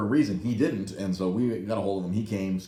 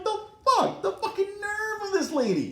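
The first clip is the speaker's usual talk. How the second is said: high-pitched, scandalized squeal; indignant outburst. Retold or imitated. Fuming about the towing lady's nerve, indignant outburst. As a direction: voice shoots way up in mock disbelief. Retelling outrage at tow lady.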